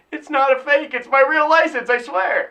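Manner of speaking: high-pitched, insistent pleading; exasperated protest. Retold or imitated. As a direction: protest your innocence, mock desperate. Acted protest at being disbelieved.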